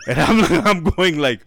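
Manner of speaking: words pushed through real laughter; laughing while talking. Real, not acted. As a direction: crack up at your own story mid-sentence. Cracks up telling his own joke.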